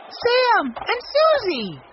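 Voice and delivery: high, drawn-out shout of greeting. A narrator's reading. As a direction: call out their names, slow and high. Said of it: "shouted Benny".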